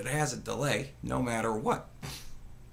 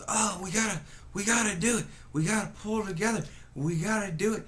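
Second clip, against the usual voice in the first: lightly voiced pleading politician, a touch higher; a hint of a desperate rallying plea; mock grim pleading; 5 semitones higher, faster than usual. Streamer mocking Biden's speeches, mild grim pleading. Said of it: "pleading fucking grim sound".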